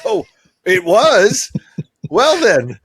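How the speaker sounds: high, loud, slow mock astonishment; mock surprise. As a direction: play the dad pretending to be shocked. Dad mocking kids' praise.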